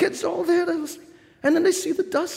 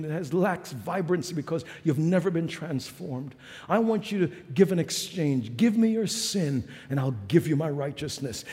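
hushed sermon; 11 semitones lower, 7 dB quieter than usual. Acted. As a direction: draw them in with a near-whisper sermon. Hushed intimate preaching passage.

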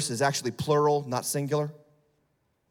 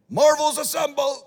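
excited Avengers call; 10 semitones higher, 5 dB louder than usual. Acted.

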